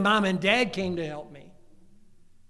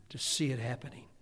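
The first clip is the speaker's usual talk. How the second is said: ordinary talk, nothing marked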